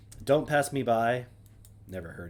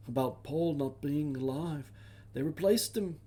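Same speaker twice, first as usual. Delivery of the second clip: put-on a weak stab at a British accent, pitched higher; half-hearted British accent, higher up; failed British accent; 3 semitones higher than usual. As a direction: try a British accent and miss it. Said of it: "I can't really do that accent".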